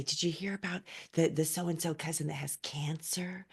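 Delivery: imitated gossipy whisper, dropping away to breath; hushed whisper. Relatives passing on scary news about a cousin, hushed whisper. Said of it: "they'd, like, whisper it".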